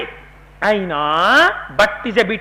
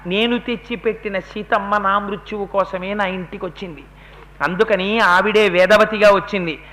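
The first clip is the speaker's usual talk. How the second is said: ordinary talk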